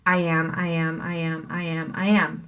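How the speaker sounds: "I am" repeated rhythmically, stress on AM; iambic beat. Shown as a demonstration. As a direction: tick off five quick iambs on "I am". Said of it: "mark out how you can see each".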